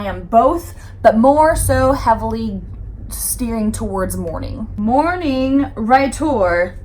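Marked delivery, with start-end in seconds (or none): 4.8-6.8 slow, drawn-out as if writing; dictating slowly. Real, not acted. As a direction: spell it out while writing. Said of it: "writing it down aloud".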